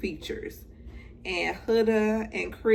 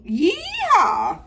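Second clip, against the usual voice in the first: high, loud rodeo yeehaw; cowgirl whoop; 13 semitones higher, 10 dB louder than usual. Pretend.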